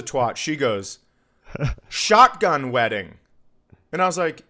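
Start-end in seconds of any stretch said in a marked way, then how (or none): 1.9-3.1 put-on loud, slow drunken shout; imitating a drunk heckler, drawn out. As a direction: blurt it out loudly like a drunk heckler. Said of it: "this super drunk".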